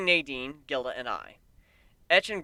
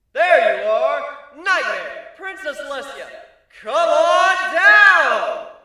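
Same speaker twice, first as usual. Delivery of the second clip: much higher, louder, slow game-show call; showman shout; 12 semitones higher, 14 dB louder, much slower than usual. A narrator's reading. Griff calling the princesses to the stage, loud showman shout. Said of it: "act the part of searching for drama".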